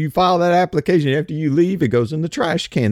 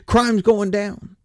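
mock official voice; 6 semitones higher, much slower than usual. Mocking officials' claim.

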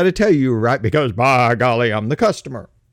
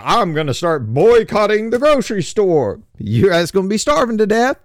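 raised, higher pompous customer threat; hammed-up blustering customer, louder and higher; mock angry customer; 7 semitones higher, 4 dB louder than usual. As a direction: puff up like a bossy customer making threats. Customer threatening grocery store.

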